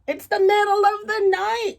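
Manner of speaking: a hint of a crone roused from sleep; old woman. Old woman woken by knocking, high loud old woman voice.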